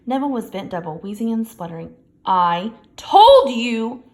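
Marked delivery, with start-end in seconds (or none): 2.2-4.0 loud, slow, stretched exclamation; gasped outburst. A narrator's reading. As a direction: burst out breathless and insistent. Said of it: "Hermione gasped".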